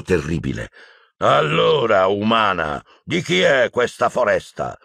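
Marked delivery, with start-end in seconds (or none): 1.2-4.8 mock-mild centaur yell; yelled challenge. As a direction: shout it at her as a threat. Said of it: "urlò Bane".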